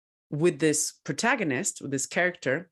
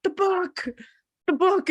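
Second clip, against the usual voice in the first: high, louder exasperated insisting; exasperated wail; 4 dB louder than usual. Retold or imitated. Girl insisting to her confused mom, high exasperated wail. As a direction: whine it like the answer is obvious. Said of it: "I'm really crying, and I'm angry".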